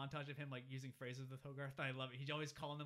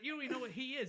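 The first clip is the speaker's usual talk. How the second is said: mock protest; 11 semitones higher, 7 dB louder, faster than usual. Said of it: "he's like".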